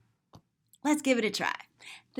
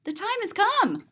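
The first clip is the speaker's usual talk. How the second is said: higher, insistent storybook voice; announcing the time; 7 semitones higher than usual. Acted. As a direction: point at the watch and insist. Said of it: "pointing and saying".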